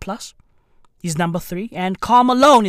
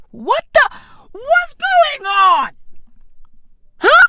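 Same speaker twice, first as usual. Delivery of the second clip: mock shock; 4 dB louder, slower than usual. Real, not acted. Shocked reaction to vaccine headline.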